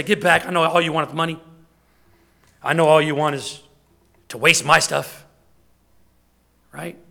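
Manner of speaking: loud, scornful dismissal; harsh rejection. Acted. Church member rejecting a lost man, loud and scornful. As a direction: push the man away with contempt.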